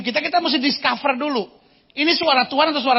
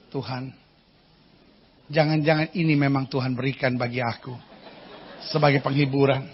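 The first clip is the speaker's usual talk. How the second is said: much softer, lower murmured prayer; mock pious prayer; 10 semitones lower, 6 dB quieter than usual. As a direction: pretend to piously justify it. Mocking a husband's self-serving prayer. (doa lirih yang pura-pura saleh)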